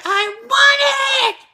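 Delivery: drawn-out, piercingly high mimicked child scream; screaming kid tantrum. Kid in a crowded store to mom, drawn-out screaming. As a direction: stretch it into a child's shriek. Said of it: "he was much louder than I was".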